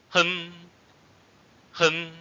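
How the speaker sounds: "Hen" held high and level. Shown as a demonstration. Teacher shows learners the third tone, high level tone. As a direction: say "Hen" on a high, flat pitch, as if jolted. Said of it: "or high level tone".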